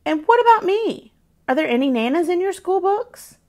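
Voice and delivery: teasing mock offence; playful grandmother. A narrator's reading. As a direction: Nana pretending to feel left out. Nana teases Grace.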